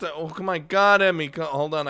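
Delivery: ordinary talk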